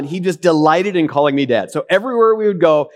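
normal talking voice

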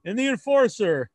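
loud, slow wrestling promo; hype introduction. Acted. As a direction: boast it like a ring announcer. Host self-introduction in hype voice.

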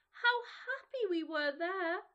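put-on slow, wistful children remembering. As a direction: sigh it with longing. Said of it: "they said to each other".

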